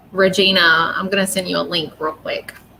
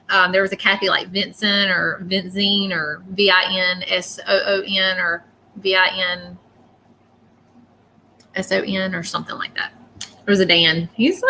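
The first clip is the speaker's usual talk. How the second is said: normal talking voice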